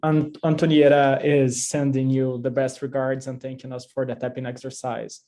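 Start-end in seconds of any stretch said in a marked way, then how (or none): none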